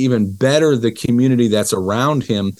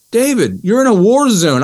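incredulous; 10 semitones higher, 4 dB louder than usual. Retold or imitated. Ron answers David's loan request.

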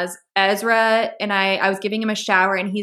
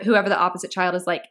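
ordinary talk, nothing marked

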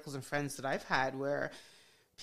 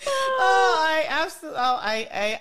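laughing; 13 semitones higher, 7 dB louder than usual. Real, not acted. Host bursts laughing at story.

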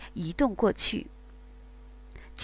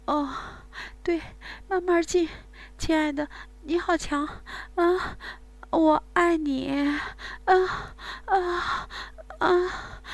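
aroused moaning woman; 6 semitones higher than usual. Acted. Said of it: "迷乱地呻吟着".

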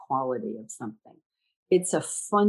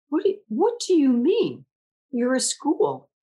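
baffled donor; 8 semitones higher, 3 dB louder than usual. Retold or imitated. Donor baffled by her answer.

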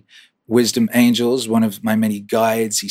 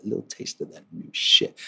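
whisper; 5 semitones lower, 8 dB quieter than usual. Real, not acted. Teasing aside.

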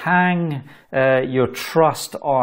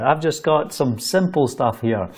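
normal talking voice

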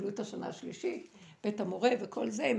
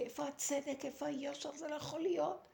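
high-pitched anguished cry, held back in volume; imitating a desperate plea, pitched up; retold outcry; 6 semitones higher than usual. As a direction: re-enact crying out in protest without full volume. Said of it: "וצעקתי".

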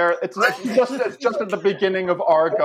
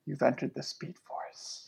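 soft, far-off whisper; eerie hushed voice; 3 semitones lower, 15 dB quieter than usual. Acted. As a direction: murmur it like a ghostly singer. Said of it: "the lady with blue hair says".

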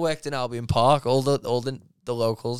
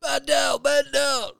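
mock radio call; 6 dB louder, slower than usual. Calling out over radio joke.

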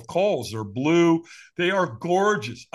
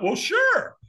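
very high-pitched retold consent; eager agreement. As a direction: agree readily, pitch leaping up. Acts out agreeing to watch.

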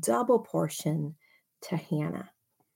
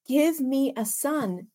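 begging; 7 semitones higher, slower than usual. Acted.

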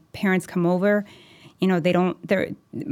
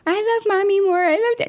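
put-on kid voice; 13 semitones higher, much faster than usual. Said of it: "and they're like".

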